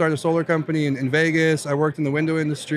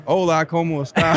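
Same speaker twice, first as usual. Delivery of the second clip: a quick laughing hola with a Mexican lilt; Mexican Spanish accent. Retold or imitated. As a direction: show off the old Mexican accent. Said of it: "I would love to hear that".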